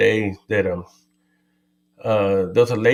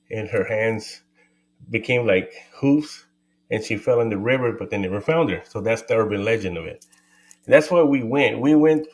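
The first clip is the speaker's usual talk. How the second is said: normal talking voice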